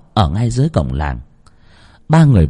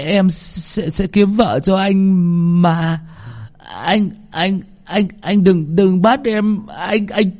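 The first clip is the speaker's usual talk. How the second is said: high, stammering, terrified pleading; delirious mumbling; 8 semitones higher than usual. A narrator's reading. Dazed boy begging his tormentor for mercy. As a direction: beg in a feverish daze. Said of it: "lẩm bẩm".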